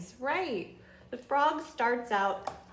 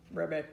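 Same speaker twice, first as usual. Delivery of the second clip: frog ribbit; 5 semitones lower than usual. Pretend. Teacher croaks like frog.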